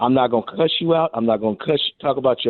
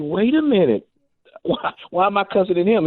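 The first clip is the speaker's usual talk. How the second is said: catching himself; 7 semitones higher than usual. Retold or imitated. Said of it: "I'm like".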